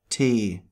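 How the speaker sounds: German Tee with a pure long E. Shown as a demonstration. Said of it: "It's E, like in".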